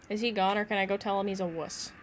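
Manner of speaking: ordinary delivery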